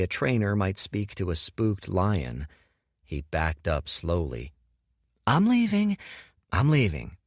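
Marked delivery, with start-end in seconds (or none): 5.3-6.0 much higher, soothing back-off; placating retreat. Acted. Dorian backs away from furious Kuruk, placating and high. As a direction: ease away like from a spooked lion.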